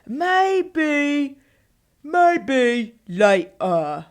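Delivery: loud, drawn-out fake British accent. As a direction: badly imitate a British pop star, very slowly. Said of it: "trying to sound like [name]".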